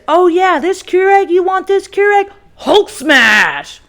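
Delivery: high, loud taunting voice; mock him sneering then bellowing. The ex taunting her before smashing the Keurig, high sneering mock. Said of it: "And he goes".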